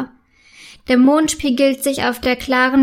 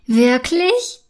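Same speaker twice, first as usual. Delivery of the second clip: rising, hopeful disbelief; pleased, wondering question; delighted. A narrator's reading. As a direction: ask in happy surprise. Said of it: "fragt Silbermond erfreut".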